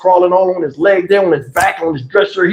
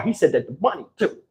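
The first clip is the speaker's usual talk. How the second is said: ordinary talk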